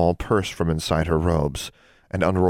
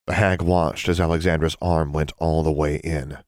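usual speaking voice

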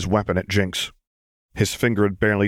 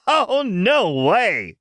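giddy Jinx voice; 9 semitones higher than usual. A narrator's reading.

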